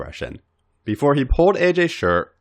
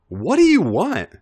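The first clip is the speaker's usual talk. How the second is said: higher, louder playful ask; teasing question; 9 semitones higher, 4 dB louder than usual. A narrator's reading.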